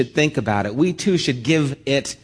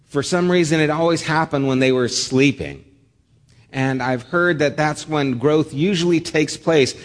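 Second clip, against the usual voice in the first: normal talking voice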